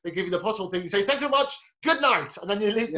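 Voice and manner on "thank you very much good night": high-pitched mock farewell to a crowd; stage sign-off. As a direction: imitate a comic closing the show. Listener acts the comic's sign-off.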